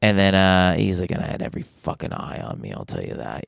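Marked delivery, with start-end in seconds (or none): 1.1-3.4 hushed, low impression of a booming voice; Jeff. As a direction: drop it low and soft, imitating a deep-voiced older comic. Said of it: "such a low, booming voice".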